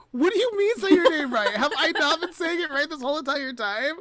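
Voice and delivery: acting shocked at being wrong all along; retold disbelief. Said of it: "And I was like".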